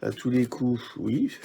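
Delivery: ordinary talk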